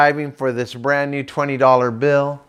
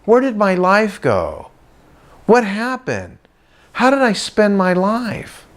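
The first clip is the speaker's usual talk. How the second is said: bewildered regret; 6 semitones higher, slower than usual. Retold or imitated. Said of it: "they open up their wallet and they go".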